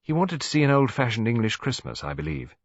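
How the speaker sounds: plain reading voice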